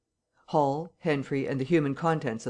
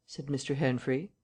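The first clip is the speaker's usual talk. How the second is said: ordinary delivery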